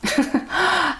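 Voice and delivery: a real high-pitched laugh breaking out; high giggle. Real, not acted. Reacting to the coworker's outburst, a real high giggle. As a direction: crack up at the memory.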